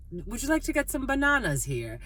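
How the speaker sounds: lightly put-on British accent for a retold quote. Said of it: "he had the British accent".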